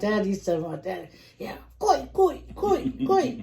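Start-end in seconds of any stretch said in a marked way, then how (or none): 1.4-3.4 high, far-off call of koi; high-pitched chant of koi. Retold or imitated. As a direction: yell it like a dad at the game. Dad calling out at the match.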